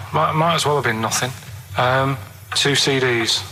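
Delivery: ordinary talk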